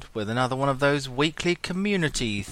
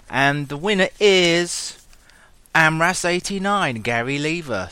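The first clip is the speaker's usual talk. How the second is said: dramatic announcement; 4 semitones higher, 5 dB louder than usual. Acted. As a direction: announce it like an awards host. Announcing competition winner.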